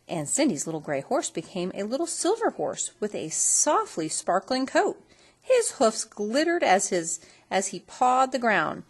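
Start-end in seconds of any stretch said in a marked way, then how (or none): none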